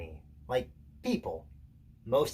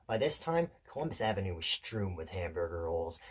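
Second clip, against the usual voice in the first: ordinary delivery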